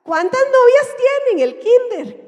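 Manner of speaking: high, loud, slow cooing question; gushing adult to child. Acted. Adult quizzing a kindergarten boy about girlfriends, exaggerated gushing. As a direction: coo it loudly, way up high, to a little one.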